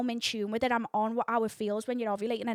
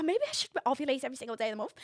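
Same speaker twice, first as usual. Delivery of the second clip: ordinary delivery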